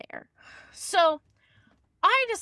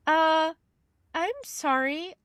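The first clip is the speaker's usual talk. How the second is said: higher, puzzled client voice, lightly played; a hint of a baffled landlord correcting her; imitating the confused client; 5 semitones higher than usual. Landlord telling the caller he doesn't own Wisconsin properties, mildly puzzled. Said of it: "is like".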